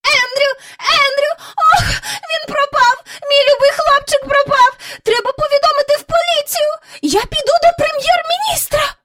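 put-on very high, loud wailing old lady voice; frantic crying lady. A narrator's reading. Miss Lark wailing for her lost dog, high and tearful. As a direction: shriek it like a panicked mistress. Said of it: "знай кричала".

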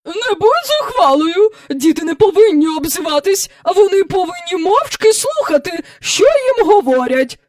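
put-on high, loud, indignant old-fashioned lady; imperious scold. A narrator's reading. Visitor scolding the girl about stockings, imperious. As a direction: lecture the child in outrage.

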